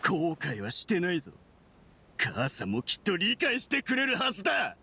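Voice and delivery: defiant and unrepentant; defiant outburst. Acted. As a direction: argue back hotly, pitch pushed up. Villain defends himself against accusers.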